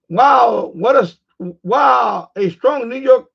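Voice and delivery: loud mock-amazed "wow", slowed down; reading comment aloud. Vlogger reading a viewer's comment about his accent, loud and amazed. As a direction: play up the commenter's astonishment.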